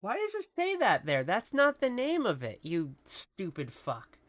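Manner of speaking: ordinary talk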